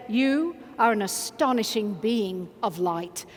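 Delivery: slow, low, deliberate sincerity; with full meaning. Shown as a demonstration. Coach shows buddies what to say to each other, full meaning. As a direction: say it steadily, meaning every word. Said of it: "say with every bit of meaning you've".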